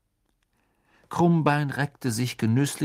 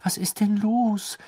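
put-on high, astonished girl's question; completely baffled; 11 semitones higher, slower than usual. A narrator's reading. Hermine finds Hagrid sobbing, completely baffled. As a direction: ask in high-pitched bewilderment. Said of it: "fragte Hermine vollkommen baff".

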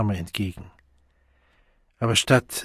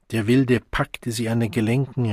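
ordinary delivery

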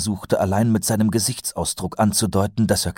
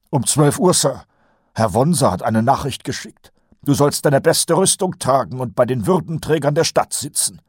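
higher, nasal-free eager sergeant voice; Kolon's voice; 8 semitones higher, 3 dB louder than usual. A narrator's reading. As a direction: play the chatty old sergeant, pitched up. Colon voiced by narrator. (höher gesprochener Feldwebel Kolon)

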